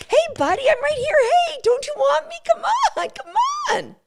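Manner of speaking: very high begging rabbit voice; a needy rabbit coaxing the dog back; pleading rabbit. Retold or imitated. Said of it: "the rabbit doesn't stop and come back and go".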